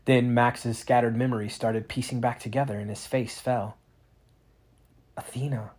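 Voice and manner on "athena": stricken whisper; unvoiced grieving whisper. A narrator's reading. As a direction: breathe her name in grief. Max remembers her death.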